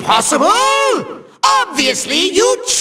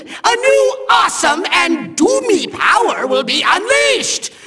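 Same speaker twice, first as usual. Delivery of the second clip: ordinary talk, nothing marked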